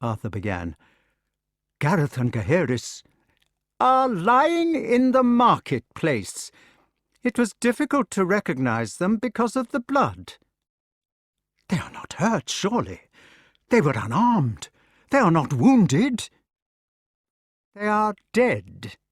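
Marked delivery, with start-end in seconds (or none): 1.8-10.4 sick son savouring the news; cruel announcement. Acted. Mordred tells deaths coldly. 11.7-16.3 high, louder pleading worry; old king begging it not be true; anxious king. Acted. King's rising alarm. 17.7-19.0 cold verdict. Acted. Mordred's flat blow.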